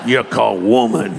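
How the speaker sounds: hammed-up Southern twang; Southern accent. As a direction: put on a comic Deep South accent. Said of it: "like he was from the South".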